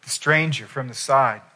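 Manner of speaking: ordinary talk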